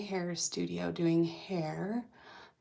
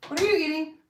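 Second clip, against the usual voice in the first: puppy talk; 8 semitones higher, 6 dB louder, much faster than usual. Real, not acted. Talking to the puppy.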